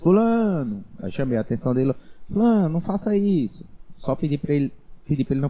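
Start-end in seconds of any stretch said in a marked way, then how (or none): 0.0-0.8 calling out. Retold or imitated. Teacher calling student in yard. 2.3-3.6 calling out. Retold or imitated. Teacher scolding student, retold.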